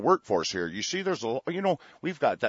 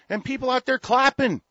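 ordinary talk, nothing marked

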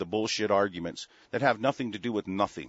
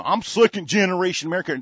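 mocking quote; 8 semitones higher, 7 dB louder, faster than usual. Mocking the immigrants' claim.

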